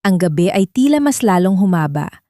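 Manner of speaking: usual speaking voice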